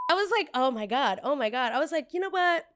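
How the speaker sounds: loud, high, exasperated; barely holding back a yell; real anger rising. Real, not acted. Retelling the brat, getting angry all over again. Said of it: "I'm really trying not to start yelling".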